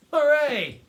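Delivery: cheering. A narrator's reading. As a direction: whoop with delight. Father cheers at the revenge.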